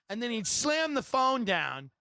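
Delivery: ordinary talk, nothing marked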